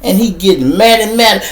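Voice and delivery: ordinary talk, nothing marked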